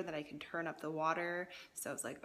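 ordinary talk

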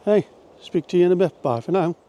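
ordinary talk

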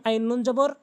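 ordinary delivery

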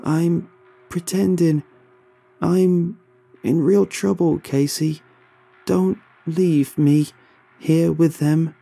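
high, loud, slow pleading; desperate plea. A narrator's reading. Kyle begging Casey through the glass, desperate. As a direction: beg her not to leave.

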